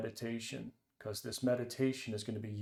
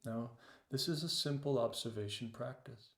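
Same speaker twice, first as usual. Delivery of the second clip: guided meditation. Acted. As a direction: ease them into the breath, unhurried. Leads a meditation.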